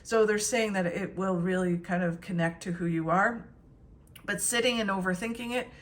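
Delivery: usual speaking voice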